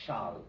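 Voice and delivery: shall dropped low as the answer; low reveal of shall; reveal word shall. Shown as a demonstration. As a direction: land shall low and final. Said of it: "a more archaic form".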